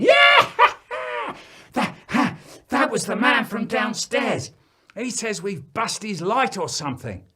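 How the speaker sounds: put-on high, loud breathless laughing child; giggling kids. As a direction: gasp it out between giggles.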